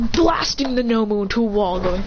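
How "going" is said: usual speaking voice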